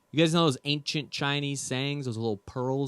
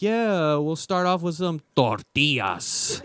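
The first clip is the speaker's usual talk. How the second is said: mock Spanish accent; 4 semitones higher, slower than usual. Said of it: "throw on the accent".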